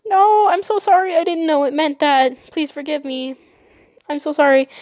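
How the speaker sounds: high, urgent begging that softens; pleading apology. Retold or imitated. Girl hugging the boy she insulted, pleading apology. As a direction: beg forgiveness in an acted, overwrought rush.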